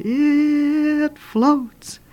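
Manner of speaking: high, drawn-out eerie moan; haunting high wail, held long; ghostly voice. Acted. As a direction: stretch it out like a distant ghost. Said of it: "at the top of the stairs it said".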